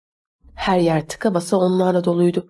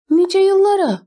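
high, startled echo of a toast; involuntary echo; 12 semitones higher, 4 dB louder than usual. A narrator's reading. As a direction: blurt it back involuntarily, pitched way up. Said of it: "dedim istemsizce".

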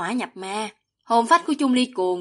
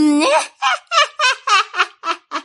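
high, staccato evil laugh; long villainess cackle, pitched very high; villainous cackle; 13 semitones higher, 4 dB louder than usual. A narrator's reading. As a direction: cackle in triumph, one sharp burst after another. Villainess laughs at her target.

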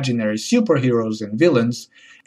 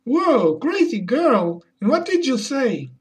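mock surprise; 11 semitones higher than usual. Reacting to the story.

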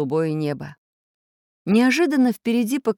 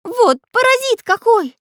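high, loud, indignant character voice; cross; 7 dB louder than usual. A narrator's reading. Magic artist swimming round his friends, crossly scolding. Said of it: "сердился волшебный художник".

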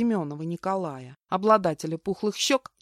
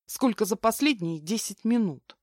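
ordinary delivery

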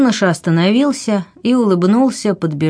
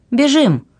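sudden high call to run; loud shout; 8 semitones higher than usual. A narrator's reading. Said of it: "громко сказала".

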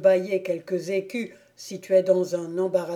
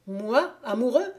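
higher-pitched, incredulous question; astonished exclamation; 4 semitones higher than usual. A narrator's reading. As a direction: exclaim in mild disbelief at the suggestion. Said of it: "s'écria Landry".

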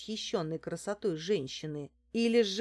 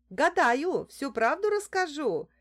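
high, loud fortune-teller's cry; squeaky; 8 semitones higher, 6 dB louder than usual. A narrator's reading. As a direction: pipe up in a high sales call. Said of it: "пропищала я в ответ". (пискливо, высоким голосом)

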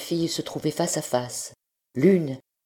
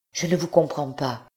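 stammering in fear; 2 semitones higher than usual. A narrator's reading. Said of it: "balbutia Blanche que la peur reprenait".

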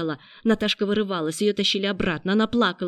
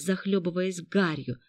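plain reading voice

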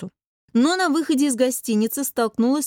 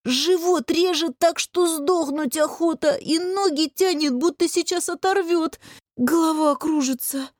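pained moaning; 7 semitones higher than usual. A narrator's reading. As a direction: whine through a bellyache. Sick man moaning about his pain. (стонет от боли, высоко и жалобно)

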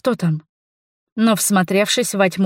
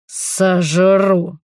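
drawn-out beastly growl; werewolf roar. A narrator's reading. Werewolf lunges at Linos, roaring. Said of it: "рявкнул он и кинулся на Линоса".

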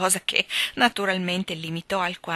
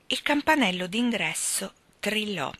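usual speaking voice

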